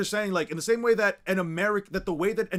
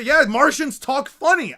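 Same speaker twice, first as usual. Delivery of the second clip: loud joking; 8 semitones higher, 10 dB louder, slower than usual. Real, not acted. Joking agreement, laughing.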